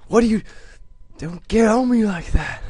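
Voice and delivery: high, loud indignant squeal; squeaky outrage. Real, not acted. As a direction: protest like you've been wronged. Indignant at game character.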